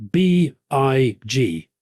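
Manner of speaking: spelled out slowly. Acted. Spelling out the promo code.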